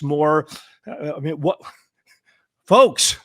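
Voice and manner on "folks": louder, raised appeal to everyone listening; rally the whole country; a loud plea to the nation; calling out to the nation. Real, not acted.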